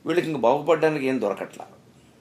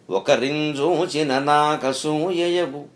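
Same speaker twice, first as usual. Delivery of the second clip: slow verse recitation; 3 semitones higher, 4 dB louder, much slower than usual. Acted. Verse quoted in a discourse.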